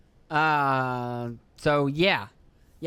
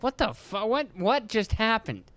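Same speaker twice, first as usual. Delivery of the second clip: higher, slow disbelief; imitating a parent caught off guard; stunned parent; 7 semitones higher, slower than usual. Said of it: "and you're like".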